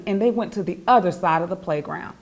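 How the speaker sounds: ordinary delivery, nothing marked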